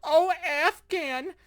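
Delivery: high, sneering mock voice, dragged out; mocking dismissive quote. Vlogger mocks the public shrugging off Afghanistan, high sneering scoff. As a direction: toss it off like nobody cares.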